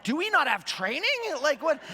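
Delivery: imitating an annoyed traveler asking if staff get training; exasperated disbelief. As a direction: act out the fed-up passenger, voice up. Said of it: "and I'm just like".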